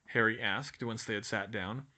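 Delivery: ordinary delivery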